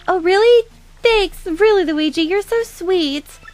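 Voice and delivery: high, sweet fangirl gushing; smitten fangirl. Acted. Fangirl thanking Luigi for coming, smitten. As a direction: gush adoringly in a high put-on voice.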